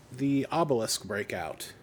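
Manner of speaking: ordinary delivery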